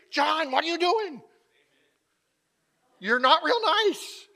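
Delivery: high, loud telling-off; blunt scolding. Acted. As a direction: call him out sharply at full voice. People confronting him bluntly.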